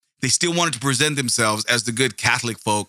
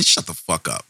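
usual speaking voice

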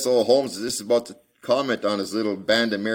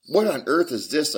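surprised cry; 10 semitones higher, 5 dB louder than usual. A narrator's reading. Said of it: "I cried".

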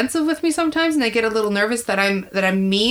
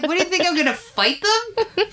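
high, laughing mock disbelief; 10 semitones higher than usual. Comedian mocking people who fear her, laughing in disbelief.

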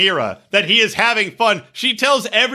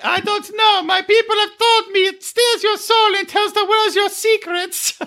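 very high, put-on mimicking voice; mock-naive high voice; high-pitched character voice; 13 semitones higher than usual. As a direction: play her reply in a squeaky, high-pitched caricature. Acting out Hazel's worried reply.

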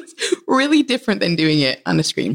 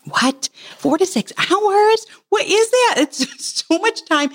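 shocked, much higher, slow disbelieving repeat; incredulous disbelief; 11 semitones higher, slower than usual. Retold or imitated. Mom hearing four to six hours outside, incredulous high squeak. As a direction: squeak it out like it's absurd. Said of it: "I thought it was completely absurd".

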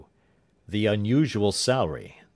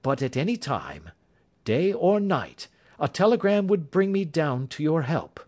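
lightly raised, confident detective voice; Holmes, calm and assured; 7 semitones higher than usual. A narrator's reading. Holmes offers his help.

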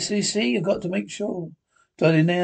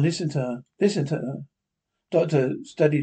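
usual speaking voice

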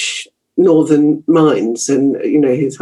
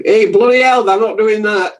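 higher-pitched broad Yorkshire street talk; rough Yorkshire kids; 6 semitones higher than usual. Retold or imitated. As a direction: call out like a rough Yorkshire kid. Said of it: "were really quite rough".

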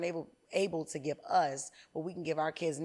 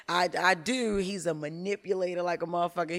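ordinary talk

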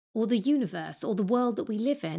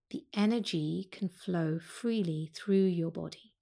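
calm yoga teacher guiding a breathing practice; guided meditation. Acted. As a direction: ease them into the breath, unhurried. Leads a seated breath meditation.

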